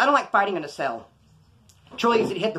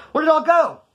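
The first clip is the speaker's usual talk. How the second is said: high, loud mock protest; indignant outcry; 10 semitones higher, 7 dB louder than usual. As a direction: cry out like you've been cheated. Retold complaint about missing food.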